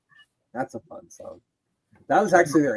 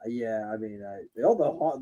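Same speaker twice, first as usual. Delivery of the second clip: ordinary delivery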